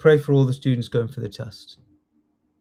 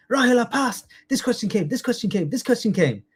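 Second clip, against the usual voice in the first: imitating students' high, eager report; breathless-style mock excitement, repeating higher than usual; excited students; 8 semitones higher, faster than usual. Students report to their teacher after passing the test, excited.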